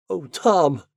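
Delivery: frightened cry. Acted. Anne horrified at bombing.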